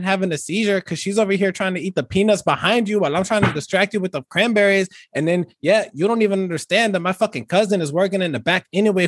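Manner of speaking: put-on laid-back Chicano street accent, every line capped with fool.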